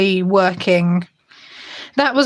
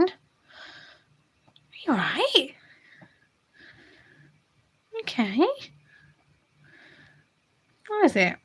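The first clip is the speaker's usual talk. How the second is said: high cooing pet talk; 7 semitones higher than usual. Real, not acted. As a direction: coo up high at a beloved cat. Coaxing cat to lie down.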